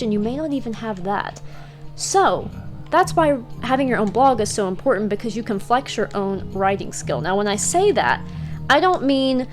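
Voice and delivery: normal talking voice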